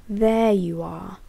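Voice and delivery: slow, menacing seethe in a strange voice; slow predator's menace; seething. Acted. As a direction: savor catching your prey. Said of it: "one seethed".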